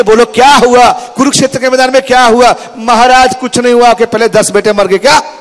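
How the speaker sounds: high, loud anxious questioning; alarmed court drama. Acted. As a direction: act out the panicked king and messenger. Dramatized Dhritarashtra and messenger. (ऊँची, घबराई हुई आवाज़ में दरबारी नाटक)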